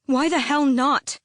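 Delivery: high, loud angry cry; furious outburst. A narrator's reading. Selena rages at Arobin over Ben's body, shouting. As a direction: hurl the question at him in fury.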